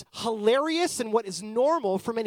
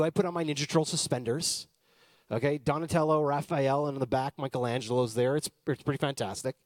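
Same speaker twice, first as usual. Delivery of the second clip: ordinary talk, nothing marked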